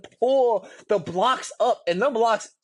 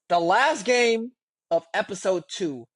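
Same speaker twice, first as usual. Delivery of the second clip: ordinary talk, nothing marked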